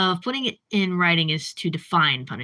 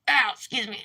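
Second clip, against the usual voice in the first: hiccuping mid-sentence; hiccups; 2 semitones higher, 3 dB quieter than usual. Real, not acted. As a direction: caught by a hiccup. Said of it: "I have the hiccups".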